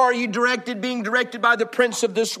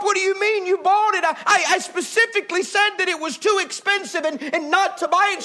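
angry husband; 6 semitones higher than usual. Acted. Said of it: "He blew his top".

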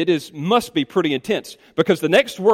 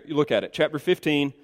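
usual speaking voice

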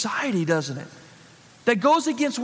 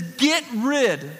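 preacher reading out a scriptural order, raised pitch; firm command; 3 semitones higher than usual. Acted. Preacher quoting Paul's order to the congregation, emphatic command. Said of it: "This is a command".

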